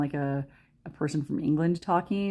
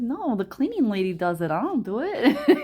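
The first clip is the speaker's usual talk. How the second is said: lightly mock New York girlfriend, rising at the end; imitated city accent; 5 semitones higher, 5 dB louder than usual. Girlfriend dismissing housework, imitated New York accent. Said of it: "And she talks like that too".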